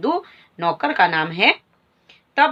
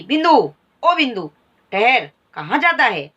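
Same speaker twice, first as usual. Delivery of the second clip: higher, louder angry call of the name; angry shout; 3 semitones higher, 4 dB louder than usual. Acted. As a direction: yell at the boy to stop. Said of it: "गुस्से में आवाज में चिल्लाने लगी".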